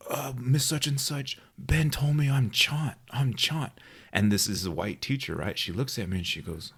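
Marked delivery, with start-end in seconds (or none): 0.1-3.7 higher, slow whiny tattle; tattling kid. Retold or imitated. Boy tattling to the teacher, high whine. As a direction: tell on him like a little kid.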